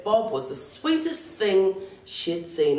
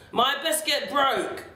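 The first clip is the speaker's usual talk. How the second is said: high, slow storybook wail; childish lament; 8 semitones higher, slower than usual. A narrator's reading. Child laments a broken biscuit.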